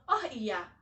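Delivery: ordinary talk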